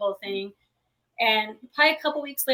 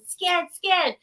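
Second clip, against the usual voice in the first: higher-pitched mock scream of fright; imitated toddler scream; 7 semitones higher than usual. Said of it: "she just comes screaming and pointing".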